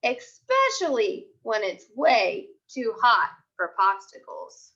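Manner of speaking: put-on lively storybook voice for young kids; picture book read-aloud. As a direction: read it like a class story time. Teacher reads picture book aloud.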